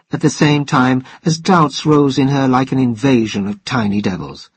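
ordinary delivery, nothing marked

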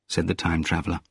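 usual speaking voice

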